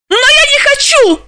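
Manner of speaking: very high, loud squealed protest; piercingly high-pitched whine of protest. A narrator's reading. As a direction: shriek your refusal. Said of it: "Далила взвизгнула".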